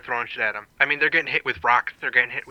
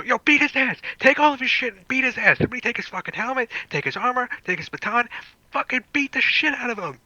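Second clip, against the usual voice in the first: high, urgent mob-rousing shouts without full volume; acted incitement yell; 12 semitones higher than usual. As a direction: egg the crowd on in a much higher, yelling voice. Player acts out inciting the crowd.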